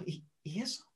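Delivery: hushed therapist curiosity; hushed curious question. Shown as a demonstration. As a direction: draw the client inward quietly. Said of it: "y usen esto".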